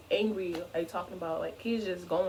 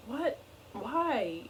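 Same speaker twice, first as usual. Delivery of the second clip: high, startled what; a retold jolt of disbelief; high-pitched retold shock; alarmed what why; 4 semitones higher than usual.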